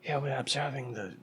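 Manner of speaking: hushed mock wildlife commentary; Jane Goodall. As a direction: drop toward a whisper, like observing animals up close. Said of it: "This is Jane Goodall".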